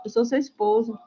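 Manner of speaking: soft, meek husband imitation; calm, hushed retelling of a patient spouse; not shouting. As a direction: gently insist on respect, voice held down. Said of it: "Ele não fala gritando".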